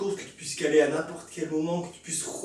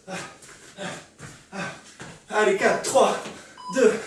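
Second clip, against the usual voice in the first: normal talking voice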